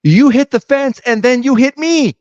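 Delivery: high-pitched mock accusation; hammed-up indignant protest, voice way up; playing an angry driver. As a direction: throw the blame back, play-acting outrage. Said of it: "I'm Ricky".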